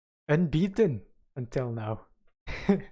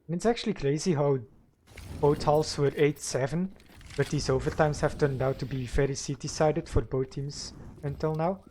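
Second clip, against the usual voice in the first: live play-by-play commentary; esports caster. Real, not acted. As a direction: call the match round by round.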